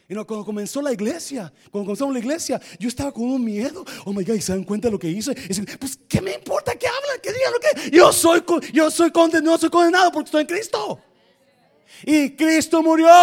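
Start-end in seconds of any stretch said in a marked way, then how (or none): 5.8-13.1 high, loud, defiant declaration; defiant shout. Retold or imitated. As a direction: shout it out with bold confidence. Cita su propia respuesta desafiante. (el creyente responde desafiante, a gritos)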